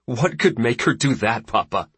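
incredulous question with scorn held back; suppressed scorn. A narrator's reading. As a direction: challenge a father while trying to stay civil. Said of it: "trying to keep the scorn from his voice".

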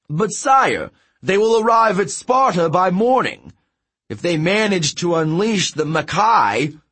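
high, loud protest to the king; alarmed messenger. Acted.

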